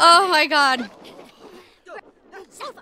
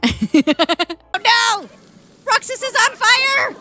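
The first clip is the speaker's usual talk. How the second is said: high-pitched cry of alarm; hammed-up panicked announcement; mock panic; 9 semitones higher, much slower than usual. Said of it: "And everybody just panics".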